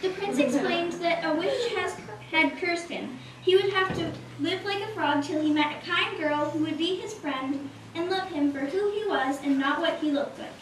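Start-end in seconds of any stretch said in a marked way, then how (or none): none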